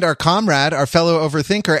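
usual speaking voice

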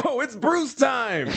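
high, slow hyped announcement; announcer call. Pretend. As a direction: sing it out like a hype man. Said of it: "Hey, Mark, what time is it?".

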